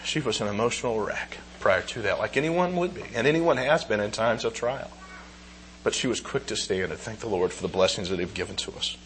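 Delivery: fighting back tears while speaking of his wife; quietly overcome, voice near breaking; emotional, choked up. Real, not acted. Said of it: "I'm sorry for getting emotional".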